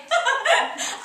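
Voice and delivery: high, giddy acted laugh; short theatrical titter; social laugh. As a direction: laugh off the awful heat. Flustered hostess laughs at own remark.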